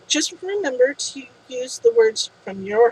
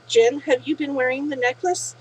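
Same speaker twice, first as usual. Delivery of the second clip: plain reading voice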